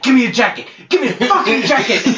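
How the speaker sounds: mock furious yell. Chef storms back demanding his jacket, mock yelling. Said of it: "he storms back and he's like".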